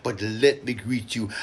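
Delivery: ordinary delivery, nothing marked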